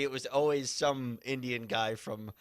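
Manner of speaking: ordinary talk, nothing marked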